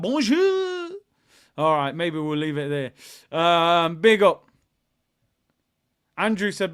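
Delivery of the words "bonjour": put-on high, drawn-out French accent flourish. Showing viewers the accent was good, French accent. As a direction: show off a comic French accent. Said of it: "You love the French accent".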